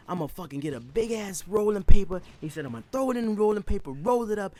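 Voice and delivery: high, loud and fast; excited kid. Retold or imitated. Josh hyping his rolling paper plan, high and loud. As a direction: rush it out, giddy and loud.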